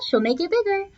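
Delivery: ordinary delivery, nothing marked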